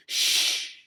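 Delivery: sharp unvoiced shush; teacher shushing the whole class. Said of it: "She just said".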